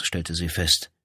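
plain reading voice

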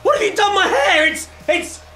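appalled outburst at the mirror; shocked outrage. Acted. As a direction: yell it on seeing the ruined hair. Sees ruined haircut.

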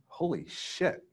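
hushed, high-pitched awe; whisper-soft amazement at your luck; awestruck. Retold or imitated. Acting out his awe at praise.

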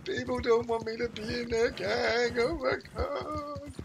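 high, slow, hammed-up whine; mock crying. Streamer mocking his younger self rejected by gangs, fake whine. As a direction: wail it like a rejected kid, half joking. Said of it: "I was like".

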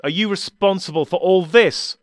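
high, loud angry question; loud accusing challenge; angry demand. Acted. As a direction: throw the blame at him. Doctor confronts his older self.

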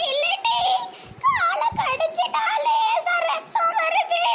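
put-on high, slow, quavering old-woman voice; very high, slow granny complaint. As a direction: play a frail, whimpering grandma. Old granny voice, bitten leg.

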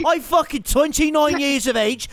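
cocky mock Irish accent, lightly done; a gamer playing brash Irish fighter Conor McGregor; swaggering put-on Irish brogue. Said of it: "you literally sound so fucking funny".

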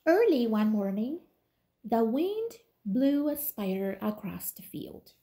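ordinary delivery

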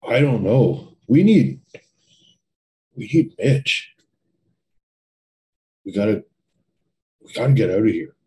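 loud, urgent and halting; rising panic. Acted. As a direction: burst out, desperate to get away. Jerry panicking, needs to escape.

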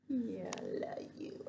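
whispered love to the dog. Real, not acted. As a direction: hush it close to the puppy. Cooing to the dog.

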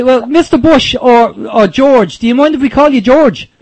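ordinary talk

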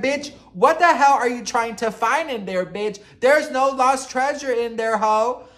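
loud, high indignant telling-off; mock yell. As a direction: shout it at him like he's digging for gold. Retelling yelling at him.

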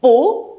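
"pú" with a rising tone; teacher's rising model of "pú"; second-tone pu. Shown as a demonstration. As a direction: lift "pú" upward like a question. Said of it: "phải được đọc thành".

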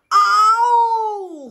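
mock roar, high and loud; loud cartoon roar; lion roar. A narrator's reading. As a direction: let out a playful roar. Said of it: "ruge o leão".

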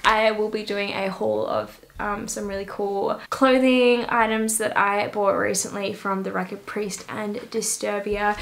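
ordinary talk

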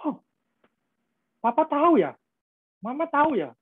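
put-on high, amazed child voice; surprised kids. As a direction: play the children, amazed their parents know. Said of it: "Mereka kan surprise loh".

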